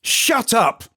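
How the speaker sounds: high, loud scream. Acted. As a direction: cut them off with a yell. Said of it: "Ash screamed".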